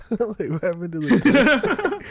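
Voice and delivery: high, laughing retelling. Real, not acted. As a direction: crack up while quoting it. Laughing while retelling quote.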